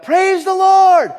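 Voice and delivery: high, loud, drawn-out holler; crying out. Retold or imitated. Southern boy at the sermon's big finish, crying out. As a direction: stretch it into a huge shout of praise. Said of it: "cried out".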